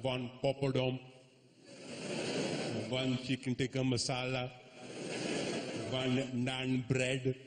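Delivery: measured mock-Indian accent; slight Indian accent. Shown as a demonstration. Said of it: "put on a slight Indian accent".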